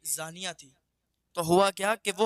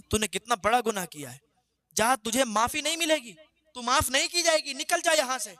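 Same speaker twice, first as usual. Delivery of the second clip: higher, louder angry shout driving her out; furious dismissal; 6 semitones higher, 5 dB louder than usual. Acted. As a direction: order her out in a blazing rage. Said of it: "غضب و جلال میں آ گئے".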